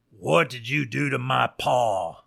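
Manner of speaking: understated wolf snarl, repeated demand; mild snarl. A narrator's reading. Jailed wolf presses the sheriff for an answer, mild snarl. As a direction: press the question with a slight snarl. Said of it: "snarls Little Wolf".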